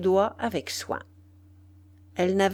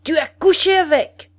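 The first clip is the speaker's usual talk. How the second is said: loud, high defiant yell; furious shout, pitched high; vociferating; 8 semitones higher, 8 dB louder than usual. A narrator's reading. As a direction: bellow the accusation defiantly. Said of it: "vociféra encore une fois".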